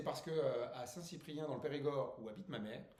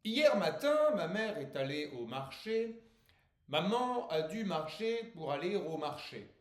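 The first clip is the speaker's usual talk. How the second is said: slow, raised declamation; poetry recitation; 4 semitones higher, 7 dB louder, slower than usual. Acted. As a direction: declaim the verse grandly, stretching the line endings. Declaims his poem. (poème déclamé avec emphase)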